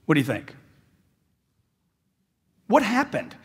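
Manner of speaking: normal talking voice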